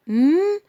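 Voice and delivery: a loud rising "mmm" on م; M from the mouth. Shown as a demonstration. Teacher contrasting M with N, emphatic hum. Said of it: "ال ام بتطلع من الفم".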